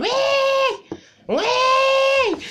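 high squealing pig noise, loud and laughing. Retold or imitated. Friend squeals like the pig they dragged, high and loud. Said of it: "On down, like".

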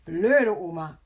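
very loud, deliberate insult; forceful, distinctly pronounced; loud and clear. A narrator's reading. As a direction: proclaim it with full volume. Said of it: "sagt laut und deutlich".

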